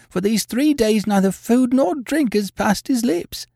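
high, agitated landlady voice; distressed landlady. A narrator's reading. Landlady telling how she insisted on a doctor, agitated.

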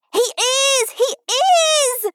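very high, loud squeal; high-pitched panto heckle; child shouting back. A narrator's reading. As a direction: insist at the top of a little girl's voice.

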